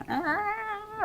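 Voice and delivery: very high screeching dinosaur cry; T-Rex roar. Retold or imitated. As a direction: shriek like a T-Rex. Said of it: "based on my dinosaur impression".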